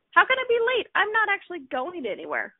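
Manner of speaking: high-pitched puzzled reaction, easing down; baffled self-questioning. Acted. Woman questioning her own borrowed worry, baffled.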